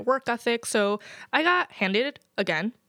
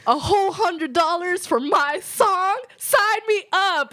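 much higher, louder, slow mock-thrilled naive artist; Mulaney Motown bit; 10 semitones higher, 5 dB louder, slower than usual. Retelling a comedy bit to her cohost, much higher and louder mock glee. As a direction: ham up a gullible singer thrilled by pennies. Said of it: "this John Mulaney bit about Motown".

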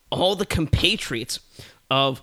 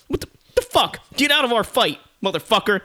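high, loud acted outrage; outraged yell; 8 semitones higher, 5 dB louder, faster than usual. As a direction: yell at an intruder to clear off. Fighters yell at an intruder.

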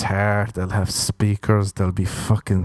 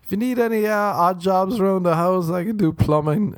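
mock chirpy influencer, higher; 9 semitones higher than usual. Mocking an Instagram model's sales pitch, high and chirpy. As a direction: pitch odd jobs in a bright, high mock voice. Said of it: "They've been hitting me up".

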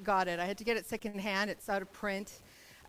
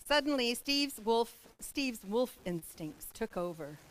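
usual speaking voice

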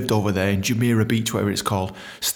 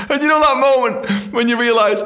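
usual speaking voice

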